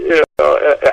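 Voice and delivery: ordinary talk, nothing marked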